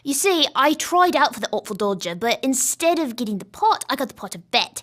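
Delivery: put-on British stage accent for the Artful Dodger. Shown as a demonstration. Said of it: "I was showing you the accent".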